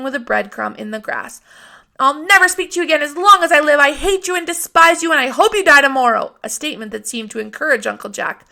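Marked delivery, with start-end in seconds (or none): 2.0-6.3 put-on loud, high, furious child yelling; furious outburst. A narrator's reading. Scout screams at Uncle Jack after her spanking. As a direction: hurl it at him in a rage.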